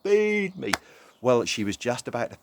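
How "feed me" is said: very high, loud chick cries; shrill little chicks crying for food; baby birds begging. A narrator's reading. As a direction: squeak it out like hungry baby birds. Baby birds, kids invited to join.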